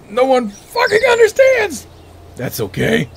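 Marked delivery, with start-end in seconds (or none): 0.1-1.9 much higher and louder, hammed-up despair; mock outburst. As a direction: throw the line back as a mock tantrum. Echoes a friend's complaint after laughing.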